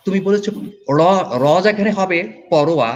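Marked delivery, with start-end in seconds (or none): none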